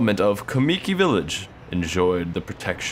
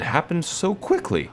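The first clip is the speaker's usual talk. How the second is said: voice crack; 11 semitones higher than usual. Real, not acted. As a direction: voice cracking up high. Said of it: "not voice crack as much".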